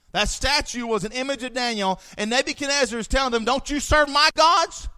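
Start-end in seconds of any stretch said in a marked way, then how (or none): 3.4-4.9 higher, louder, slow indignant challenge; indignant king. Acted. Nebuchadnezzar challenging the three Hebrews, indignant. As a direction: hurl the question down at them.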